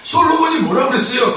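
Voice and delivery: ordinary talk, nothing marked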